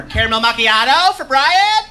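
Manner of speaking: questioning sing-song call; barista call. Shown as a demonstration. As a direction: call the name with a rising lilt. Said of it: "You gotta, or".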